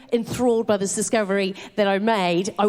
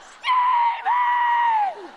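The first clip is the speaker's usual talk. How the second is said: mock shouting a name; much slower than usual. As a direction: belt the name out across the café for fun. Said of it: "I started to entertain myself".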